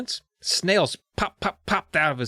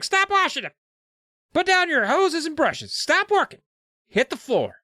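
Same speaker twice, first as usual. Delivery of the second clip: put-on girl's shouted orders; 13 semitones higher than usual. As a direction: shout the kids down, laughing at one point. Girl voiced in read story.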